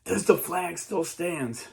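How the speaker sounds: high, slow, stretched out call; yelled question. Retold or imitated. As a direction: cry out desperately at dawn. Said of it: "they yell".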